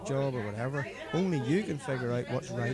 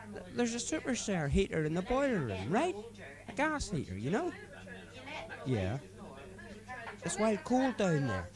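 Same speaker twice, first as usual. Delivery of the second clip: put-on higher-pitched drunken friend preaching life advice; drunk mate Paddy; 7 semitones higher than usual. Drunk driver lecturing his passenger about responsibility, higher voice. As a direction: lay out your drunken philosophy earnestly.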